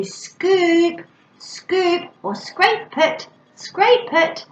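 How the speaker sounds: high, sing-song "scoop" and "scrape it"; modelling new words. Shown as a demonstration. Parent building a child's vocabulary, high modelled words. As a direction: lift each word well above normal talk. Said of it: "instead of using dip, we could do".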